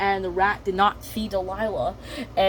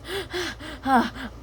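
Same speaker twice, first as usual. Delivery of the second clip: tired sigh; 6 semitones higher, 5 dB quieter than usual. Acted. Delilah catching breath.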